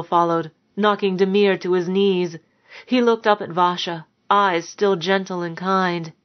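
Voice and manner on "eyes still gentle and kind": ordinary delivery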